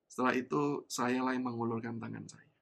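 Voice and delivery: usual speaking voice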